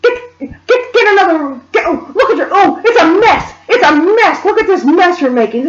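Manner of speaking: high, frantic mock scolding; sputtering, exasperated mother impression; restrained imitated screaming. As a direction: scream as the mother, held back. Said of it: "I'm holding back how this woman screamed".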